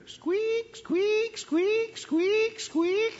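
very high, drawn-out squeaks; wheel squeak. Retold or imitated. Pastor imitating the unoiled wheel keeping him awake, squeaking very high. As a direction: mimic a squeaky hamster wheel.

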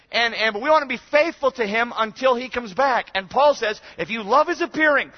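loud, high, exhorting shout; full-force preaching. Real, not acted. Preacher urging congregation to want Christ back, shouting. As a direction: cry it out to rouse the room.

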